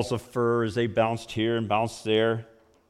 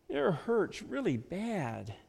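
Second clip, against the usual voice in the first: put-on suffering toddler; 6 semitones higher, 7 dB quieter, slower than usual. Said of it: "with an expression of suffering".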